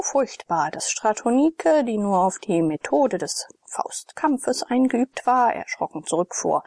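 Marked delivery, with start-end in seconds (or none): none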